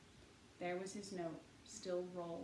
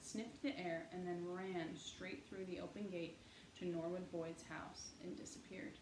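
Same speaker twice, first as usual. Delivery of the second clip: usual speaking voice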